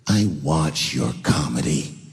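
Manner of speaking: low, slow stern lady voice; deadpan her like a mean old woman; cold old lady. Retold or imitated. Old lady at the mall telling him she watches his comedy, low and slow. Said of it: "All she said was".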